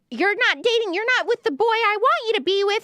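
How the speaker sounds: mock the demanding fans; mocking obsessive fan. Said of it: "making fun of the psycho fans".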